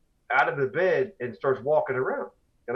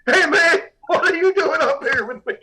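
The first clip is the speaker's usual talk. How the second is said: high, loud startled yell through a laugh; imitating a man waking up shocked; startled shout; 11 semitones higher, 6 dB louder than usual. Roddy wakes, finds Todd beside.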